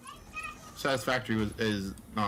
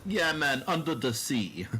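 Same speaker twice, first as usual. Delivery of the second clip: put-on light Jamaican accent, singsong; lilting Jamaican voice, high then slow; 7 semitones higher, slower than usual. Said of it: "talking about the sea in a Jamaican accent".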